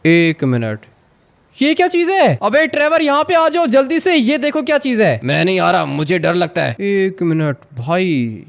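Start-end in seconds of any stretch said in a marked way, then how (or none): 1.6-5.2 high-pitched, startled call for help; urgent, much higher voice, calling a friend over; alarmed shout. Acted. Calling Trevor over to see the strange thing, alarmed.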